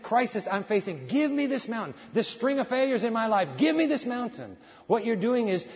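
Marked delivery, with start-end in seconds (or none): 1.1-1.9 higher, firm declaration; rising bold prayer chant. Shown as a demonstration. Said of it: "preacher's chant". 3.6-4.6 higher, firm declaration; rising bold prayer chant. Shown as a demonstration. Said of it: "preacher's chant".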